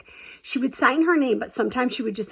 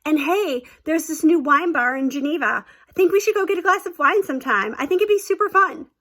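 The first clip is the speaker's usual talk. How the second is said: much higher, sugary covering voice; fake cheerful; 5 semitones higher, 4 dB louder than usual. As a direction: cover your tracks with forced cheer. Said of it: "I totally tried to cover my butt".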